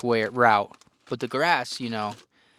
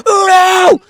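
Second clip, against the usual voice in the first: much higher, very loud scream; screaming Uno; 17 dB louder than usual. Retold or imitated. Dad slamming his last card, screaming Uno. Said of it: "screams it".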